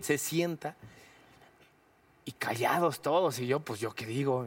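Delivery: ordinary talk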